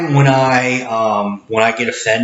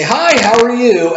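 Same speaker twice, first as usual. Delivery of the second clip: higher, louder hello called down the belt; loud cheery greeting; 12 semitones higher, 4 dB louder than usual. Shown as a demonstration. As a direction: call out to a customer at the far end of the belt. Cashier greets distant customer.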